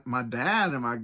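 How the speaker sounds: ordinary talk, nothing marked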